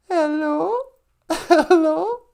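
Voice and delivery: questioning hello turning into meow; cat saying hello. Retold or imitated. Host imitates a listener's talking cat, meowed hello. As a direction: let HELLO climb into a cat's yowl. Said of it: "used to say hello too".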